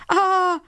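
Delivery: very high, drawn-out "ah"; little girl's mild high scream; storybook scream. A narrator's reading. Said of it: "schrie das kleine Mädchen".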